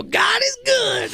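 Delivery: mock church shout; church response shout. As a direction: shout back like a joyful congregation. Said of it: "They go".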